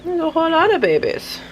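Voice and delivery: high delighted repeat; delighted squeal. Real, not acted. Repeat higher.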